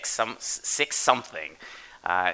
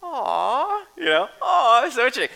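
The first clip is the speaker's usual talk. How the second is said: touched aww; 14 semitones higher, 6 dB louder than usual. Retold or imitated. Dad cooing over his daughter's handmade book, high and touched. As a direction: coo over it like a charmed parent. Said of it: "I'm, like, a little bit more like".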